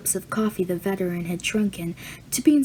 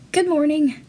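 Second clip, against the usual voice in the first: nervous greeting; 7 semitones higher, 5 dB louder than usual. A narrator's reading. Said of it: "gave a nervous".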